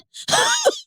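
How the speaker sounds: high laugh that breaks into a sob; tearful high laugh cracking into crying. Real, not acted. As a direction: laugh through tears, high up.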